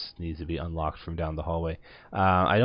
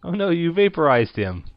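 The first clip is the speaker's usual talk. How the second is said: surprised; 11 semitones higher, 8 dB louder than usual. Real, not acted. Reacting to game event.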